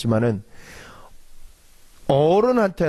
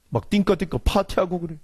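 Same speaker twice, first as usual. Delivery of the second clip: ordinary talk, nothing marked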